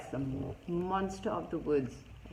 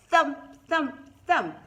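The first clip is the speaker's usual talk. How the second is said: booming knock sounds; loud thumps; 9 semitones higher, 12 dB louder than usual. Acted. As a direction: pound out each thump.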